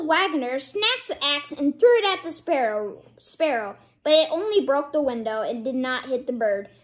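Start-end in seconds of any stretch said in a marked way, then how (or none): none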